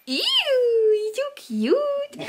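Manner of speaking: high, slow gushing squeal. Real, not acted. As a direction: gush over something adorable. Cooing at boyfriend's shoes.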